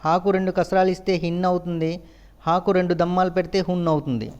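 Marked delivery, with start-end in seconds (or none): none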